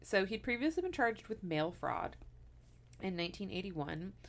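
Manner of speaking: normal talking voice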